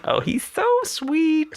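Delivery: very high, sugary imitation of the driver; mock sweet driver. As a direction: gush it up high like the oblivious driver. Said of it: "she's just like".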